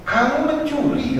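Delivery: high, drawn-out mock shock; accusing father. Father learns his child took markers, mock-scandalised.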